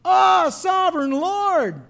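slow, high, loud anguished cry to the Lord. Retold or imitated. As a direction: stretch out a loud pleading cry. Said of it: "cried out in a loud voice".

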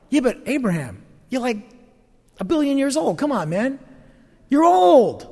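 put-on rising disbelief ending on a loud "You're old."; mock skeptical scoff. A doubter ribbing old Abraham, scoffing and incredulous. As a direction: tease him, incredulous.

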